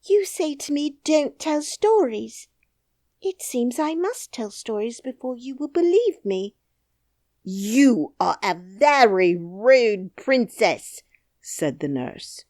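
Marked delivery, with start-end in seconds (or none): 0.0-6.5 high, calm little princess voice. Acted. Princess calmly answers the nurse, high. 7.4-11.0 loud, slow stern scolding; stern nurse. Acted. Nurse scolds the princess, slow and loud.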